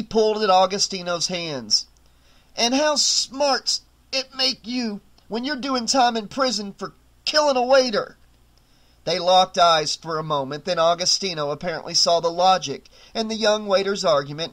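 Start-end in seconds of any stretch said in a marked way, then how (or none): 2.6-8.2 strained defiance. A narrator's reading. Aldous choked, defiant.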